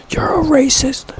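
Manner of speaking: high, slow whispered accusation; mock whisper. Whispering a mock accusation at himself, high and slow. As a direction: hiss it out slowly like a ghost.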